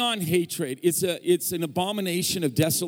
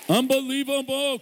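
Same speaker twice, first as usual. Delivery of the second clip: even higher, held cheer; more excited; 11 semitones higher, 3 dB louder than usual. Real, not acted. Guest preacher urging the congregation's applause, high excited cheer. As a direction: top the first one, really belt the wonder.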